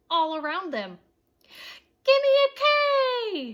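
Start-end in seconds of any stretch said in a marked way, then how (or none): 2.0-3.5 high, loud cheer; cheerleader shout. A narrator's reading. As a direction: belt it like a pep squad. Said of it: "shouted the cheerleaders".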